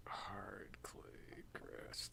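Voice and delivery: whispering the words to himself; whispered, barely audible. Real, not acted. Repeats clue words under breath.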